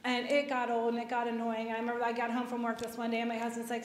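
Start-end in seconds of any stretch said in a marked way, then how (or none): none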